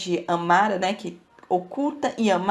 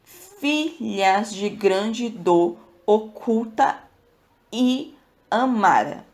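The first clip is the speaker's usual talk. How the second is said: slow, deliberate reading of the line; teacher reading a line of poetry slowly; slow verse reading; much slower than usual. Shown as a demonstration. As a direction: read the verse out slowly and clearly. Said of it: "O segundo verso é esse".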